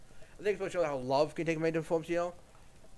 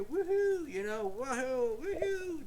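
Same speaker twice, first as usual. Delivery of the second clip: high squealed woohoo; mock cheer; 9 semitones higher, slower than usual. Crowd cheering the paraded baby, high mock whoops. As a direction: whoop it up in a silly falsetto. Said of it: "be like".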